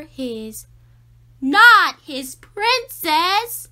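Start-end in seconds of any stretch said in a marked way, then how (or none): 1.4-3.7 a slow, indignant protest; loud outraged cry. A narrator's reading. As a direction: shout it slowly, much louder and higher. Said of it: "cried Olivia".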